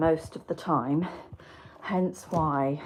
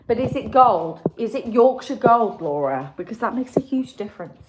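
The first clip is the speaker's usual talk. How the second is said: mock outrage; 4 semitones higher, 11 dB louder than usual. Real, not acted. Mock-urgent questioning of viewer's tea.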